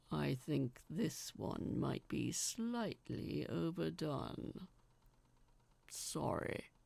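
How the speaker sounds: low, slow sheepish giant; giant's voice. Acted. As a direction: admit it sheepishly like a big gentle giant. Giant speaks, voiced figure.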